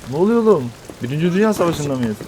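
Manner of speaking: high, loud confused outburst; startled. Real, not acted. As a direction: shout in disbelief. Confused by sudden war scene. (şaşkın, yüksek sesle)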